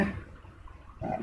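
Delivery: breathy, voiceless yaar under the breath; exasperated sigh. Real, not acted. Teacher to himself, annoyed at his pen, exasperated sigh. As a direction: sigh it out, fed up with the pad. Teacher frustrated with writing pad.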